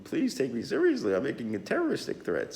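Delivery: high, quick whiny plea to be taken seriously; mock plea from a weak would-be threatener; mock pleading. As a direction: beg like a feeble man, hammed-up. Mocking feeble guy's plea.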